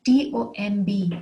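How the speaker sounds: ordinary delivery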